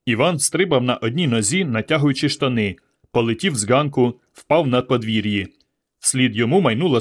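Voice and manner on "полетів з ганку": plain reading voice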